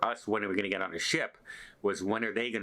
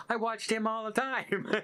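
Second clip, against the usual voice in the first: higher, delighted mom quote; gushing mom; 11 semitones higher than usual. Retold or imitated. Crew member quoting her mom to the vlogger, voiced higher. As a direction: quote the proud mom, bright and high.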